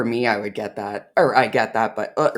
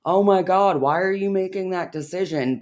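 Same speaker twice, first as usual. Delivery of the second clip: mock exasperation; 6 semitones higher than usual. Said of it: "in my head, it might be like".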